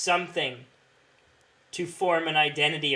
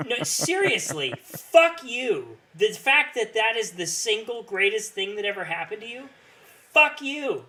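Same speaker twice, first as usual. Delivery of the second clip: angry rant; 7 semitones higher than usual. Real, not acted. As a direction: shout it at the fan. Ranting at a fan's tweet.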